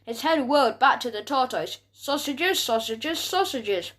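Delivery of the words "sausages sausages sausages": low growl. A narrator's reading. Dog turning back to the tortoise, low growl. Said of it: "he went in a low growl".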